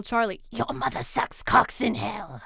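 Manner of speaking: loud, rough demonic growl; possessed demon. Acted. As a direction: rasp it out like the devil inside someone. Quoting a possessed demon, exorcism joke.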